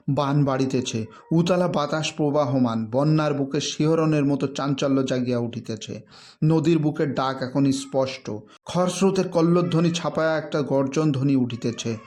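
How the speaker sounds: ordinary delivery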